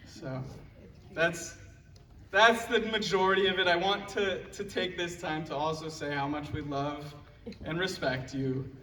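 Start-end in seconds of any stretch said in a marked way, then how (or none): none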